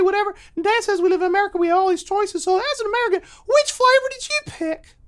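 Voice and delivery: put-on high-pitched chattering kid; squeaky child's rush of questions; young son's voice. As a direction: ramble fast like an overexcited little boy. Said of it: "he says".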